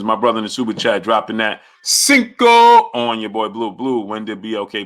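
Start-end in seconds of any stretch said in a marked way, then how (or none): 1.8-3.2 drawn-out, high, loud shout; hype shout. Real, not acted. Streamer cheering a super chat donation, loud drawn-out shout. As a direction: belt it out and stretch it.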